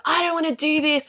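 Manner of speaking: high-pitched mock whine; frustrated child. Child struggling with the reader, frustrated whining. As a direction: complain like a child stuck on homework. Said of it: "dealing with the frustrations".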